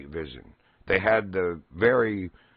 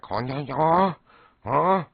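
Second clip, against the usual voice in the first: higher, puzzled huh sounds; confused enemy soldier; 7 semitones higher, 4 dB louder, slower than usual. Retold or imitated. Enemy soldier searching foliage for the hidden player. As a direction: play the soldier looking around, baffled.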